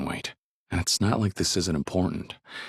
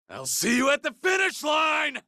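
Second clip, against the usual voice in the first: shouted challenge. Acted.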